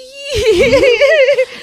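high, loud helpless laugh; loud burst of laughter. Real, not acted. Guest cracks up at the host's teasing, high loud laughter.

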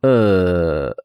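awkward hesitation. A narrator's reading. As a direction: stall with an embarrassed, sinking hum. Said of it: "脸上闪过一丝尴尬".

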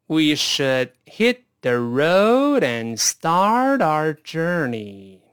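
极慢地读，每个词都拉长连起来; 带学生一词一词慢慢读，元音拖足; 慢速领读. Shown as a demonstration. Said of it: "再来一遍慢速". (超慢速示范朗读)

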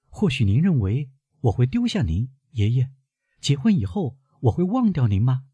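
put-on high-pitched young woman questioning her grandfather; concerned girl, pitched well up; worried granddaughter. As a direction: lift the voice high, anxious questions.

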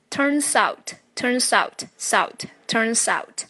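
turns out run together as "turn-zout"; linked pronunciation. Shown as a demonstration. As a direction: link the s of turns into out. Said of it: "发音技巧有连读".

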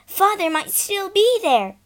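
high, loud eager shriek. Acted. Girl shrieking to siblings as the train passes. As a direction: shriek it out hopefully. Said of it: "shrieked Bobby".

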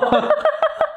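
high giggling laugh; real high laugh; laughter. Real, not acted. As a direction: crack up mid-story. Teller laughs at story.

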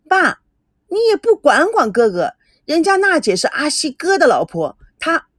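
put-on high, pleading childish protest; a daughter whining to dad, high-pitched; whiny complaint. A narrator's reading. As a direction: whine to dad about the brother. Girl complains to father.

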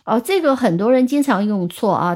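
normal talking voice

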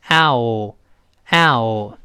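“ow” gliding from ah into o; gliding diphthong. Shown as a demonstration. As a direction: slide from an open ah to o in each “ow”. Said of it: "由啊滑到这个o". (“ow”由“啊”滑到“o”)

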